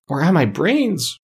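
high, drawn-out mild wail. A narrator's reading. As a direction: lament it slowly on a high pitch. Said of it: "wailed the Scarecrow".

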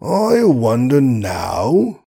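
slow thoughtful musing; meditative. Acted. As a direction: draw it out, pondering. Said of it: "said he in meditative wise".

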